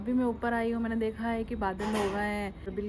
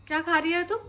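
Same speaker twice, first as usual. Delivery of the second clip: raised, teasing scold; scolding call; 6 semitones higher, 6 dB louder than usual. Real, not acted. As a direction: catch her sneaking sweets. Mother catches child eating.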